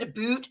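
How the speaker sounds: "boot" with a long oo, for "about"; mock Canadian "about". Shown as a demonstration. Denying how Canadians talk, "boot" with long oo. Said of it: "That's the Easterners".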